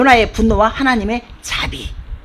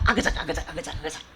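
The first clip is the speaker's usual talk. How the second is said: imitated chewing; 7 semitones lower, 12 dB quieter, faster than usual. Worm chewing the vine root.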